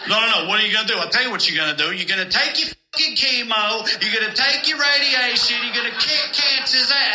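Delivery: rising Bronx tough-love rant; Bronx doctor pep talk. Retold or imitated. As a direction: pump the patient up, Bronx style. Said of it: "I didn't want a Bronx doctor".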